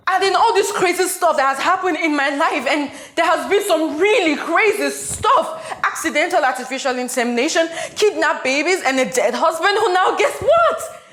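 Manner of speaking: loud, high, agitated venting; frantic rant. Acted. Frantic rant about her life.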